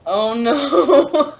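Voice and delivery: loud, high, laughing "oh no"; laughing dismay. Real, not acted. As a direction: caught out and laughing at herself. Realizes thing is left in car.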